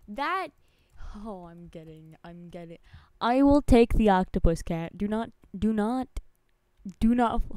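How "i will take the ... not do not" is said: mock threat.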